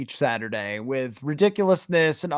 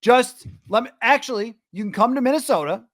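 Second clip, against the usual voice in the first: mock-indignant call-out; 7 semitones higher, faster than usual. Real, not acted. Host tells Cleveland reporters to learn practice, indignant call-out. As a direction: call them out in a raised, exasperated pitch.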